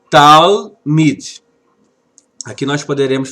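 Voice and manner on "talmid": talmid, said louder and clearly with a falling end; model word talmid. Shown as a demonstration. Hebrew teacher reads talmid aloud, firm and clear. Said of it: "a expressão é essa aqui".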